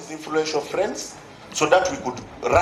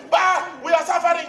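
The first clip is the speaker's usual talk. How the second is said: mock protest shout; 10 semitones higher than usual. Voicing rioter.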